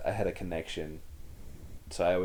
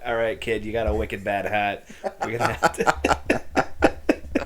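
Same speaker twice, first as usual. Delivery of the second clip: louder, higher Boston surgeon; mock Boston accent; 3 semitones higher, 8 dB louder, slower than usual. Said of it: "if your heart surgeon was like".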